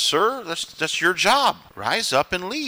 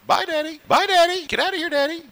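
put-on much higher, sing-song child voice; little daughter; 12 semitones higher than usual. Father imitates daughter Jordan waving him off, high child voice. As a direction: chirp it like a cheeky little girl. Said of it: "She's always happy when I go".

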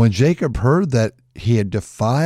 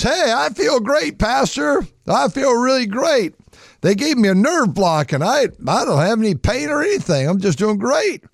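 high, bright-eyed voice of a patient feeling no pain; cheerful patient; 11 semitones higher than usual. Retold or imitated. Congregation member telling his pastor he feels great, in a high cheery voice. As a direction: chirp it like a man high on painkillers. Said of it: "he goes".

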